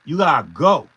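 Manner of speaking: loud, rising shout; shouted dismissal. Real, not acted. Beatmaker ordering the commenter out of his channel, shouting. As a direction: throw him out with one loud call.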